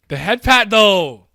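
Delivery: high, loud fan excitement over the moment; excited squeal. Real, not acted. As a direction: gush it out loud. Fan excitement over head pat.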